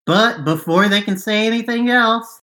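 ordinary talk, nothing marked

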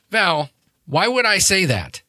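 high-pitched, exasperated protest; protesting, pitch climbing on the repeat; indignant denial. Acted. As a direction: throw the accusation back as a question. Character denies an accusation of lying.

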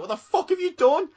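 imitating the sound guy's mock horror; panicked sound guy. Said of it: "He was like".

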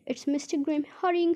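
ordinary delivery

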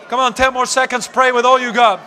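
loud, urgent preacher's push; fired-up exhortation. Real, not acted. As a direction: spur them on loudly. Urges congregation to pray.

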